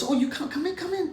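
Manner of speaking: very high, sing-song welcome; squeaky secretary. Retold or imitated. Secretary welcoming him in, high and chirpy.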